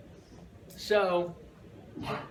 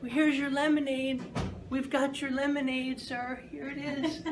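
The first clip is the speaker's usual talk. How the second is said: put-on higher, gentle little girl voice; sweet little girl; 9 semitones higher than usual. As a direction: offer the cup so carefully, like a tiny girl. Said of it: "And she comes".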